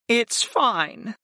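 high, sighed reassurance. A narrator's reading. Servant accepting her master's apology, sighing. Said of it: "Sarah sighed".

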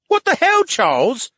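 high, loud startled shout from the brain in the tank; jolted and yelling at Charles. Acted. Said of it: "A B shouted".

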